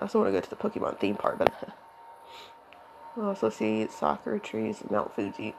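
cracking voice from allergies. Real, not acted. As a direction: let the voice crack and catch. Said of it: "Allergies are making my voice crack".

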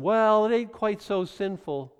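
high, slow mock excuse. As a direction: drawl out a lame excuse. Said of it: "when you double down".

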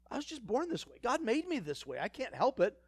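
high, whiny excuse-maker; mock excuse. Preacher mocking people excusing their sin, high and whiny. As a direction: whine the excuses high like a sulky child.

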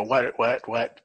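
ordinary talk, nothing marked

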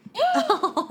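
high burst of laughter. Real, not acted. Reacting to the quote.

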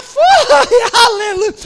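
high laughing burst of praise; laughing aleluia. Real, not acted. Pastor laughing as he praises God.

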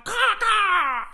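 high, loud cawing; crow caws. Acted. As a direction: squawk like a crow.

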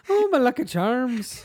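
cartoon Irish accent, poking fun; mock Irish exclamation. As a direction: play a stage Irishman for laughs. Mocking the Irish reviewer.

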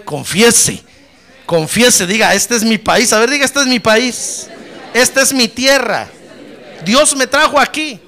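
loud, emphatic preacher's call for repetition; rousing declaration. Real, not acted. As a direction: lead the congregation phrase by phrase, forcefully. Leads congregation in shouted declaration. (declaración de fe enérgica, a viva voz)

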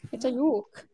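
ordinary talk, nothing marked

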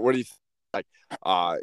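ordinary talk

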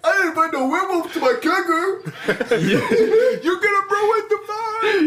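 high, loud laughing werewolf. Pretend. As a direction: talk like a howling werewolf. Player speaks as werewolf.

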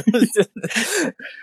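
high burst of giggling laughter. Real, not acted. Reader laughing during the manga recap, high laughter. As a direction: crack up mid-story.

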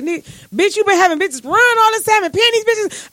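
high, incredulous replay of the discovery; retold disbelief. Woman retelling confronting ex boyfriend, high incredulous call-out.

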